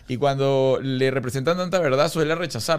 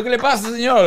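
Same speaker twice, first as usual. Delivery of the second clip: high, louder, laughing mock cry; laughing mock plea; 8 semitones higher, 5 dB louder than usual. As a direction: play it up through a laugh. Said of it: "Yo digo".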